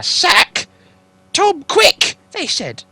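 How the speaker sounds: very high, loud urgent troll cry; trolls shouting. Acted. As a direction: yell for the sack in a hurry.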